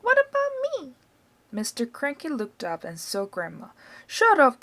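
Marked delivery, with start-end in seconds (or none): 0.0-0.9 put-on high, cackly old grandma calling down; high-pitched old woman showing off; Grandma's voice. A narrator's reading. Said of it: "Grandma shouted". 4.1-4.6 loud and higher; annoyed father shouting up at Grandma. A narrator's reading.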